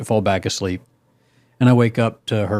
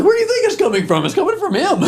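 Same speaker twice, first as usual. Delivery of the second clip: much higher, laughing exasperation; exasperated retort. Retold or imitated. Man telling wife the dog stinks, much higher laughing exasperation. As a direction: squeal it out like the answer is obvious. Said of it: "I'm like".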